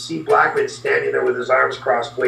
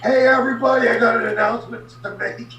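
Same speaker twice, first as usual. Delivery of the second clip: grab everyone's attention; announcing; 7 semitones higher than usual. Retold or imitated. Bradshaw announcing in catering.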